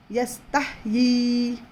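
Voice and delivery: यस्ताहयी slowly with the ता held long; slow recitation. Shown as a demonstration. Teacher reciting यस्ताहयी slowly for the class. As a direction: draw out यस्ताहयी syllable by syllable. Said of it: "दो हरकत जितना खींच कर पढ़ना है".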